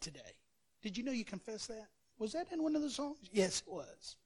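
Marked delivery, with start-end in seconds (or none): none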